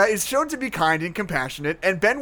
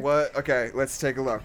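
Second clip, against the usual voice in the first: ordinary delivery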